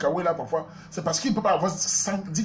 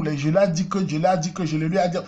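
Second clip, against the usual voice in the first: normal talking voice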